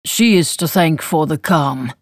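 slow, rumbling nobleman. Acted. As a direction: rumble it out slowly. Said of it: "proclaimed in his rumbling voice".

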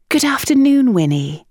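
a lightly voiced composed lady; Eugenia's voice. A narrator's reading. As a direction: greet an old friend with pleasure. Eugenia greets her old friend.

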